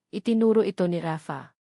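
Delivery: usual speaking voice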